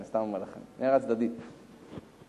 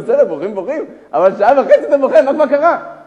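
raised, loud acted questioning; insistent stranger; 11 semitones higher, 17 dB louder than usual. Stranger pressing a man who wept for hours, insistent. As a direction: build to an incredulous loud demand.

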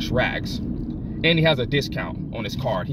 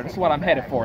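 ordinary delivery

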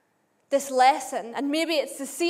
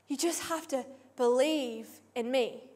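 much softer, intimate assurance; softer, plain pace; 3 semitones lower, 7 dB quieter than usual. Acted. Preacher voicing Jesus answering the crowd, much softer. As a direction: say it quietly, close to the listener.

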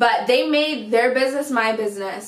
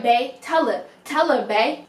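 usual speaking voice

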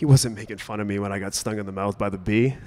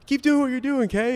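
very high, loud mimicry of an old man; mock it back as a jeering crowd; squeaky-high taunting imitation; 4 dB louder than usual. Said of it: "they start mocking him".

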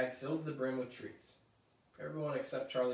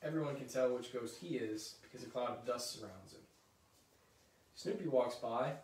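ordinary delivery

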